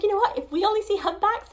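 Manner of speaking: high, fast, eager burst; quick, excited hope about the humpbacks. Retold or imitated. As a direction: blurt it out with excitement. Excitedly telling the others her hopes.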